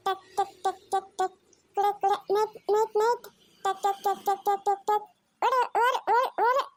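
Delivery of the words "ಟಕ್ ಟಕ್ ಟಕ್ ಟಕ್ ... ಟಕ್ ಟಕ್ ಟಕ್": clip-clop noises. Acted. Child riding toy horse.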